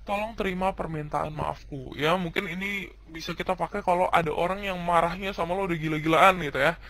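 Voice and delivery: ordinary delivery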